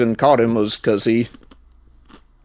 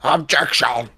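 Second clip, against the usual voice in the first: Alba objection; 3 semitones higher, much slower than usual. Retold or imitated. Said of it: "that's the best I can do".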